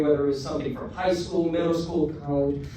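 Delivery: usual speaking voice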